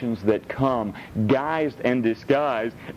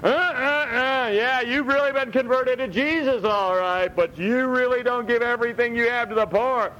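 voicing Satan's accusation; 10 semitones higher than usual. Preacher voices Satan accusing the new convert, sneering and high. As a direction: needle them like the accuser. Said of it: "Satan's accusation is".

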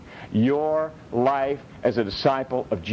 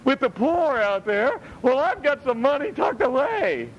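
high, put-on voice of a guilt-ridden saver; mock guilty believer; 12 semitones higher than usual. Preacher imitates a believer feeling accused over savings, fretful and high. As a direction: voice a fretful churchgoer excusing himself. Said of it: "And you're like".

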